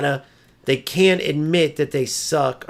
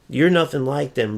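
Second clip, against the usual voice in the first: ordinary talk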